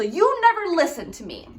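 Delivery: high accusing complaint; accusing whine. Shown as a demonstration. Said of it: "What's something that we could say instead".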